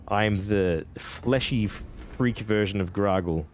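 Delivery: ordinary talk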